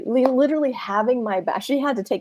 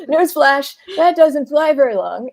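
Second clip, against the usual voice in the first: wry newsflash; 6 semitones higher, 7 dB louder than usual. Real, not acted. Wry punchline after laugh.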